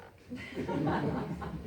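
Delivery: quick airy laugh. Real, not acted. Narrator laughing at shared joke.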